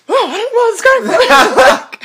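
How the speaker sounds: very high, slow, laughing confusion; bewildered laugh. Retold or imitated. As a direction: squeal out the confusion through a laugh. Bewildered taking the money.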